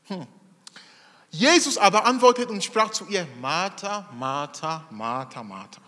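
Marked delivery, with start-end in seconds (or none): 3.4-5.8 gentle, fading. Acted. Jesus gently answers Martha.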